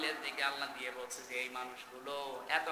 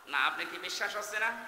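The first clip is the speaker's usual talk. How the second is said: ordinary delivery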